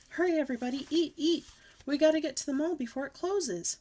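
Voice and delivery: high, loud urging; hammed-up kid excitement; excited hurry. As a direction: rush everyone along. Child urging family.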